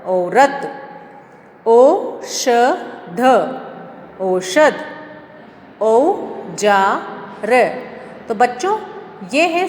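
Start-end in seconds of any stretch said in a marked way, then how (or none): 1.7-3.2 औषध drawn out very slowly; teacher drawling औषध slowly; slow stretched reading. Shown as a demonstration. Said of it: "यहाँ देखिए". 5.8-7.5 औजार drawn out very slowly; teacher drawling औजार slowly; slow stretched reading. Shown as a demonstration. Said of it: "यहाँ देखिए".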